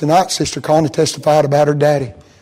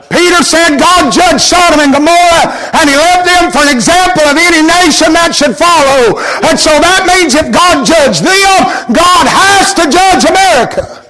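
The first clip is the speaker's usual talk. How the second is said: preaching at full force; 9 semitones higher than usual. Acted. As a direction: climb to a preaching peak.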